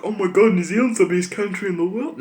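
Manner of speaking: a hammed-up, starry-eyed "oh my god"; mocking gushing patriot. Mocking countrymen who brag about New Zealand, gushing parody. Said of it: "everyone's like".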